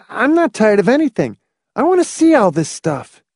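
put-on high-pitched boy's voice; speculative boy. A narrator's reading. Boy answering Hamilton, high boyish voice. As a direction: speak up high like a keen boy. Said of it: "Speculatively, David said".

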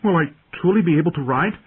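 put-on high, eager boy's question; youthful, wondering, lifted voice; hopeful boy. A narrator's reading. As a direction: ask like a boy longing to believe it.

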